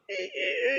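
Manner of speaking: high squeaking door imitation; squeaky door. The pull door of the hide squeaks as he opens it, high squeal. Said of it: "shut the door".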